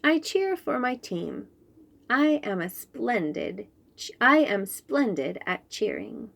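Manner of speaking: plain reading voice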